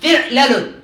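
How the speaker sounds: «فعلل» as fi'-la-lun; fatha on lam. Shown as a demonstration. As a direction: say «فعلل» with i first, then a on lam. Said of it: "نعم، بالفتح".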